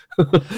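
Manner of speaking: high, short laugh; quick high giggle. Real, not acted. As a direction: laugh at an absurd question. Laughs at absurd form question.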